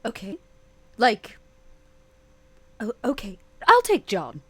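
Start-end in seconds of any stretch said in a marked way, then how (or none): none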